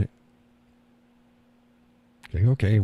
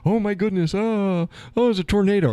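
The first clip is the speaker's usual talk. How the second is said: higher, hammed-up fake panic; mock panic; 12 semitones higher than usual. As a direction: play a silly panicked worrier. Mocking someone panicking over tornado.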